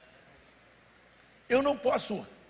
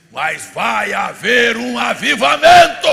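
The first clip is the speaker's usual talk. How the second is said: slow, building shout, much louder at the end; rousing proclamation; 3 semitones higher, 16 dB louder, slower than usual. Acted. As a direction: draw it out into a revival cry. Preacher proclaims revival to congregation.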